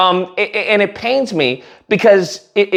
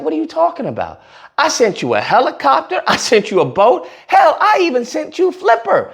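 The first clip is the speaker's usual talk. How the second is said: higher-pitched incredulous retort; mock exasperation; 6 semitones higher than usual. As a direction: act out an exasperated God. God answers him, joke punchline.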